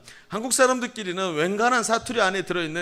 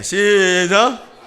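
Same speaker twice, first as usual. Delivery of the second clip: drawn-out loud cue; 2 semitones higher, 8 dB louder, much slower than usual. Real, not acted. Pastor cues congregation to greet each other, loud and drawn out. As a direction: call it out long and loud.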